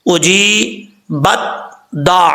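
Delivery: slow اجی بت دا with تا sounded; correct tajweed. Shown as a demonstration. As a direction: give the تا its quality, then the doubled دال. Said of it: "وہی طریقہ اختیار کرنا ہے۔". (درست: تا کی صفات ظاہر کر کے پڑھیں)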